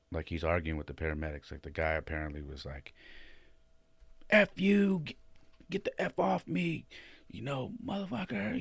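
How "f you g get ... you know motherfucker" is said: mock combative yell, pitched up high; half-hearted imitation of a combative drunk cursing; retold yelling. Retelling the injured man fighting off the paramedics, mock yell. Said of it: "just yelling at everybody".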